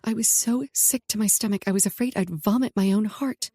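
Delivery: ordinary delivery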